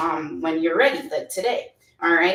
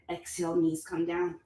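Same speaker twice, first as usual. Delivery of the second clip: normal talking voice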